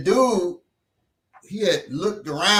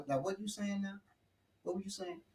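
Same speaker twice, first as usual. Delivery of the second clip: much softer, cold threat; menacing quiet; 18 dB quieter than usual. Retold or imitated. Father's menacing quiet reply.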